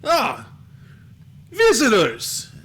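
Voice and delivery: high, loud call with a drawn-out word; shouted greeting, stretched out; hearty yell. Acted. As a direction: yell it out to the newcomers. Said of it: "a couple of men yell out".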